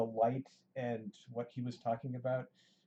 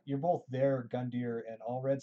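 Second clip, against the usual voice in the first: normal talking voice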